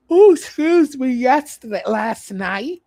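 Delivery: talking through a yawn. Real, not acted. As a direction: yawn out the words. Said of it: "Excuse me for yawning".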